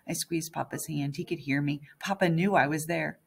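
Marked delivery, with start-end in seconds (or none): none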